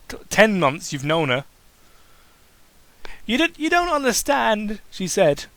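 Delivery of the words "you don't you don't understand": high, pleading and hurt; upset pony begging to be understood; getting sad. Acted. Reader voicing Pinkie as she starts to get sad. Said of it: "starting to get sad".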